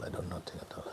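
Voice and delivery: hushed inward whisper. Shown as a demonstration. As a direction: let it fall to a bare breath. Repeats line, then whispers it.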